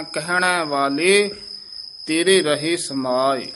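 slow "ਕਹਿਣ" with an open ai ending, pause after "ਵਾਲੇ"; correct pronunciation demo. Shown as a demonstration. Teacher modelling the wording, slow and exact. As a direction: stretch "ਕਹਿਣ ਵਾਲੇ", rise, then pause. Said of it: "ਇਸੇ ਤਰ੍ਹਾਂ ਹੀ ਉਚਾਰਨ ਕਰਨਾ ਹੈ".

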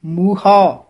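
ਮੂਹਾ said as muha, no nasal, stress on mu; ਮੂਹਾ clearly as muha, no nasal; correct pronunciation. Shown as a demonstration. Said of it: "ਮੂਹਾ ਉਚਾਰਨ ਕਰਨਾ ਹੈ".

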